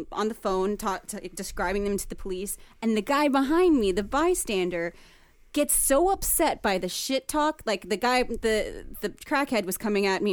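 ordinary talk, nothing marked

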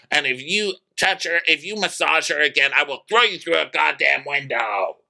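high mock-furious scolding; worked-up warning in a high voice; angry rant. Retelling Craig's angry rant.

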